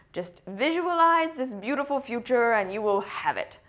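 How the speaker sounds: lightly mocking, airy quoted advice; imitating self-help advice-givers. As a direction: parrot glib advice in a slightly put-on voice. Voicing people's glib self-help advice.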